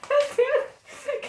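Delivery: high, breaking into laughter; helpless amused exclamation; laughing. Real, not acted. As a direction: gasp it out through giggles. Bursts out laughing at her daughter.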